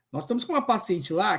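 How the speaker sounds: ordinary talk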